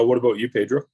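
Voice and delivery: usual speaking voice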